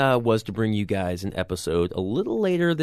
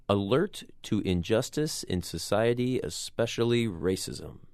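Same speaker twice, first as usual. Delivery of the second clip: normal talking voice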